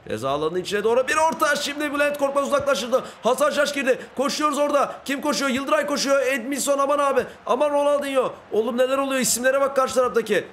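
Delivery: high-pitched, excited running commentary; live play-by-play. Pretend. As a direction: call every pass like a football commentator. (heyecanlı, tiz sesli maç anlatımı)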